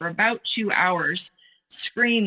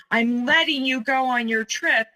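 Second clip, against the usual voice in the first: retold yelling; 6 semitones higher than usual. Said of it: "he was yelling".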